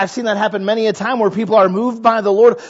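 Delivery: usual speaking voice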